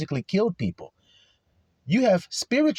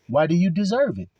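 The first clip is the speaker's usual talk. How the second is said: ordinary delivery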